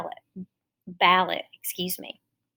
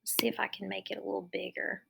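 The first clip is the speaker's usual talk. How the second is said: fast murmured aside; hushed side note; whispered aside; 13 dB quieter, faster than usual. Real, not acted. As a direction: whisper it quickly to yourself. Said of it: "adjusting the screen".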